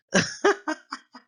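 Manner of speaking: high-pitched burst of laughter; real laughing fit. Real, not acted. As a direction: crack up helplessly, no words. Cracks up at the accented order.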